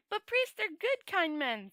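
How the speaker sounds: high, eager, wondering tourist voice; a naive visitor gushing over a solemn ritual; Two Flower. Acted. The tourist telling Rincewind about the ceremony, high and eager.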